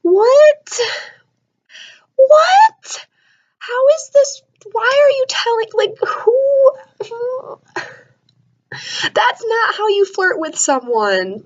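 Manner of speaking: shrieking disbelief, close to crying; horrified disbelief. Retold or imitated. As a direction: squeal it like you can't believe it. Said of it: "And I was like".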